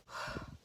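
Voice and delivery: a shaky sigh to steady herself; emotional sigh. Real, not acted. Vlogger steadying herself while retelling the proposal, sighing. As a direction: let out a sigh, gathering yourself. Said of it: "I need to collect myself".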